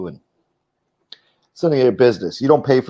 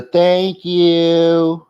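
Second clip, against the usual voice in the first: higher, louder Long Island "thank you"; mock Long Island accent; 8 semitones higher, 4 dB louder than usual. Streamer mocking how Angel talks, Long Island accent. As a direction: exaggerate her Long Island accent for laughs. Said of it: "She got a Long Island accent".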